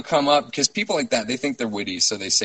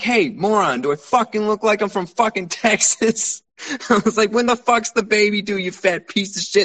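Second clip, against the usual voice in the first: higher, louder furious insults; retelling a furious yell, raised pitch; angry retort; 9 semitones higher, 4 dB louder than usual. Retold or imitated. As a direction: snap back at the stranger, higher.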